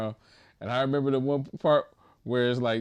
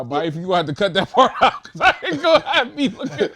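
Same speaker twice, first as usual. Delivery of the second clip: higher and louder, laughing through it; laughing plea; 7 semitones higher, 7 dB louder than usual. Retold or imitated. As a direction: beg while cracking up. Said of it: "I was like".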